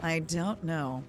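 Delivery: slow, knowing deadpan; drawn-out mock innocence; sarcastic. Streamer teasing chat that he doesn't know, slow sarcastic. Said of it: "kinda sounds like I do know".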